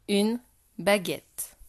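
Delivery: slow dictation with a pause after "Une"; article, pause, then the word. Shown as a demonstration. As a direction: dictate it slowly for a pupil to write. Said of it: "Je vais dire des mots".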